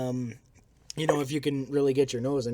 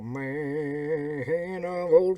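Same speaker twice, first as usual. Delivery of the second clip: put-on slow, drawn-out old radio voice; old-timey radio announcer; 5 semitones higher, 7 dB louder, much slower than usual. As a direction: speak into the glass like vintage radio. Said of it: "Like an old-timey radio announcer".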